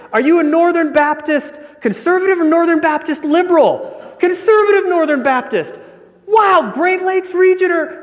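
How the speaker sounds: hammed-up excited joke dialogue; mounting excitement. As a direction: build to a giddy peak as each match is found. Escalating exchange in a joke.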